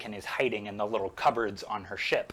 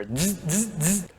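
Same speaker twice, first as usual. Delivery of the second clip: lightsaber buzz; 4 semitones higher than usual. Retold or imitated. Lightsaber noise imitation.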